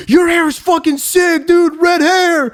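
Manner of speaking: high-pitched retold fanboy exclamation; excited strangers. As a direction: call it out like an amazed passer-by, drawing out the end. Acting out strangers admiring his hair.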